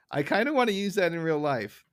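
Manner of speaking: usual speaking voice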